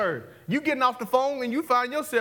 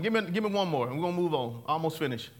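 ordinary talk, nothing marked

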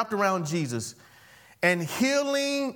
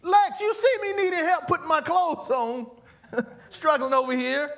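high, loud fed-up shout with laughter; exasperated complaint; 9 semitones higher, 3 dB louder than usual. Retold or imitated. Speaker scolding Lex for not helping, high shout. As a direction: complain loudly that nobody helps.

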